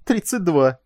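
mildly giggly delivery; tittering. A narrator's reading. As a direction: drop the punchline with glee. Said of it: "хихикнул Теки".

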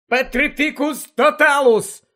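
high, loud shriek of the spell; screamed spell. Acted. Harry screaming a spell at the army of the dead. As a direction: scream the curse out. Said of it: "зарепетував Гаррі".

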